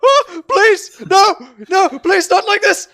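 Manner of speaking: high, loud panicked pleading; hammed-up frantic begging in a high voice; terrified hostage. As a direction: beg for your life, shrieking high. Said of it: "go like".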